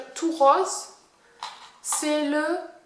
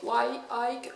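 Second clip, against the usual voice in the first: slow, careful French-accented English brand name; awkward English accent on a product name; attempted English accent; much slower than usual. Real, not acted. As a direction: sound out the English name the way a French speaker does. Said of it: "désolé pour mon anglais".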